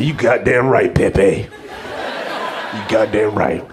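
low-pitched, self-satisfied reply; acted low, smug approval; smug victor. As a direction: drop the voice low and lord it over him. Comic's smug answer to pleading man.

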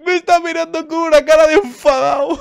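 very high, loud squeal; loud high-pitched freak-out; hysterical alarm. Real, not acted. Laughing panic as baby glares.

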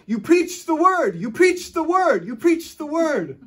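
put-on an old mentor yelling praise at the preacher; joyful yelling. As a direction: shout the praise, overjoyed. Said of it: "he always yelled when he talked".

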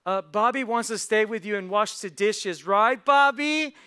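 high, loud sing-out to Grandma; little sister. Acted. Sister volunteering her brother for dishes, loud and pointed. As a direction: put her brother on the spot, stretching the last words. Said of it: "Sally goes".

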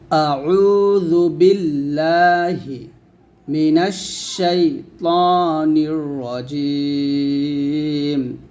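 slow, drawn-out recitation; solemn measured reading. Shown as a demonstration. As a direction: recite the taawwuz slowly and fully. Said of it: "তাউজটা হচ্ছে যে".

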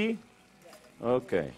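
ordinary talk, nothing marked

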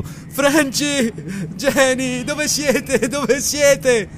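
very high, far-carrying cries; desperate calling. A narrator's reading. Lamley searches the snow for his family, desperate calling. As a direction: call out frantically for lost loved ones. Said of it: "e chiamando".

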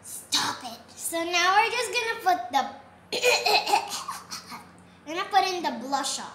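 loud laughing protest; being tickled. Real, not acted. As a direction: laugh out while squirming.

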